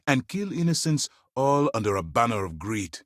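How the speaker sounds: ordinary talk, nothing marked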